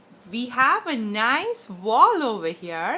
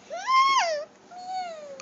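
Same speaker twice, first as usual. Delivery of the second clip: very high squeaky little meow; cat meow. Shown as a demonstration. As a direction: mew like a tiny kitten, fading off. Teacher imitates cat for child.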